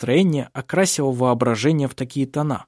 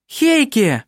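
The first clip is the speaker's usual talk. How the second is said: high, delighted cry of his name; joyful exclamation; excited; 11 semitones higher, 4 dB louder than usual. A narrator's reading. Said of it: "восторженно воскликнула она".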